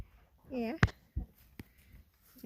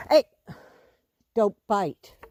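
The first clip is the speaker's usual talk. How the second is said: sharp warning; 7 dB louder than usual. Real, not acted. Horse tries to bite.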